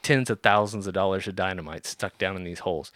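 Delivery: normal talking voice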